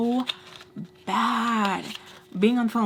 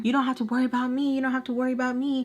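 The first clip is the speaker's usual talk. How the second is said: higher, rapid-fire repeated protest; mock scream without much volume, rushed; retold phone screaming; 4 semitones higher, much faster than usual. Friend fighting back over the phone, retold screaming. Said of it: "we're screaming on the phone".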